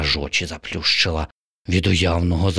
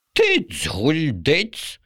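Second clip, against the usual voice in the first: very slow, higher scoffing pigeon; snorting pigeon; 10 semitones higher, much slower than usual. Acted. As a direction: scoff at the silly idea. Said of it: "пирхнув голуб".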